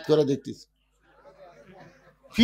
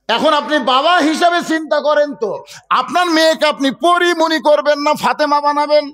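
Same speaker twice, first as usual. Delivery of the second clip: raised, high-pitched sermon challenge; impassioned preaching; 11 semitones higher, 4 dB louder than usual. Real, not acted. Preacher challenging fathers about raising their daughters, impassioned. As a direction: press the crowd with a preacher's question.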